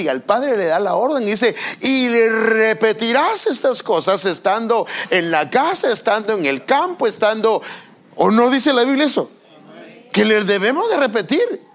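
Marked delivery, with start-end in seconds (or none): 1.8-7.6 lento y alto, leyendo la Escritura con peso; cita bíblica solemne. Acted. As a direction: proclama el versículo despacio. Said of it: "la Biblia dice". (lectura bíblica solemne y pausada)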